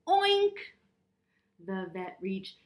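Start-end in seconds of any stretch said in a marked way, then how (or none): 0.1-0.6 high, loud piggy oink. A narrator's reading. Puppy George oinking at the vet. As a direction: squeal it like a pig. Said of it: "George went".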